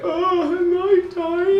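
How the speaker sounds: very high, slow, teary falsetto; mock tearful. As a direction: coo it like a weepy cliché, drawn out. Mocking a sappy show moment.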